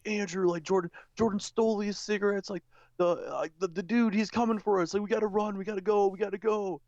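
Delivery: high, frantic pleading to run; mock panic. To drunk Andrew, urging him to run, mock panicked. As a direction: rush him off in a fake panic.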